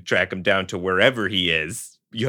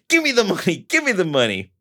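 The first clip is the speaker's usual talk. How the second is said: high, cartoonish repeated demand; Looney Tunes gunman; 10 semitones higher than usual. Retelling the gunman as a cartoon, hammed-up. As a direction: ham it up like a cartoon crook. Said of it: "so Looney Tunesy".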